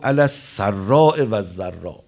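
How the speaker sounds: impassioned sermon delivery; full-force preaching. Acted. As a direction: proclaim it to the congregation.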